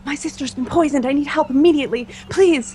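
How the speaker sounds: urgent, high-pitched plea for help; distraught. Acted. Sister begging a priest to save the poisoned girl, distraught. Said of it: "I'm still very distraught".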